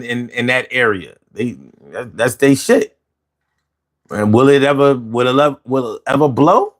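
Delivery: usual speaking voice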